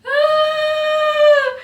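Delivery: long, high, loud wail; drawn-out mock scream of despair. Trainee reacting to the failed plan, long high wail.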